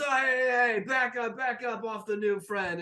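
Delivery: high, loud shooing that settles down; calling off. Acted. Burly man to crowd around new friend, calling off. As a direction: start loud and high, then ease off.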